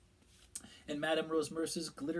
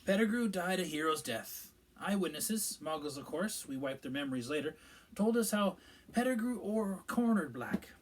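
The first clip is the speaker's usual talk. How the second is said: a higher, louder official's voice; Minister Fudge; 5 semitones higher, 5 dB louder than usual. A narrator's reading. Minister explaining events to the pub gathering, self-important. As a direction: voice the pompous minister telling the tale.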